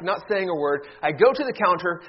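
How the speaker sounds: ordinary talk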